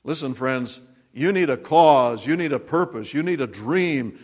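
ordinary delivery

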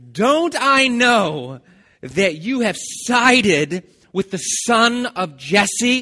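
raging King Saul accusing Jonathan; furious king. Acted. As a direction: bellow the accusation, drawing each word out. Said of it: "Saul's anger flared up at Jonathan".

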